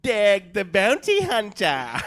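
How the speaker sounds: put-on slow, loud Australian accent on Dag the Bounty Hunter. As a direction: belt the title in a broad Australian accent. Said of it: "great Australian accents".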